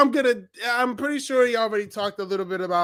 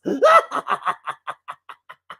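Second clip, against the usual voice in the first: villainous laugh; 4 semitones higher, 6 dB louder than usual. Pretend. Mascot gloating over hijacked channel.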